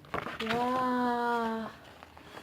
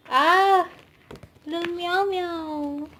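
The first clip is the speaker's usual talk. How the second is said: cooing squeal; 7 semitones higher, 6 dB louder, slower than usual. Real, not acted. Fan coos over member's photo.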